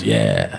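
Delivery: very low, lazy grunted yeah; barely bothering to form the word. Retold or imitated. Phone man answering the comedian with a low grunted yeah. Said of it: "first time someone explained to him".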